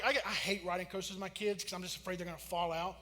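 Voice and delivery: ordinary talk, nothing marked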